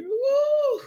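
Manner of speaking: high, drawn-out "woo"; spontaneous high cry of praise; praise whoop. Real, not acted. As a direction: whoop mid-prayer as it moves you. Praise outburst during prayer.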